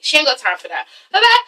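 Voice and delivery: ordinary talk, nothing marked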